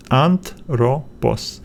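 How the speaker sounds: "Anthropos" very slowly as anth, ro, pos; split after "th". Shown as a demonstration. Teacher showing the other split, slow separate syllables. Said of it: "let's split it right here between".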